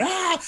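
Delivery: high mock shriek; imitated scream. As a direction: squeal like a startled onlooker. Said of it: "you guys were really just going".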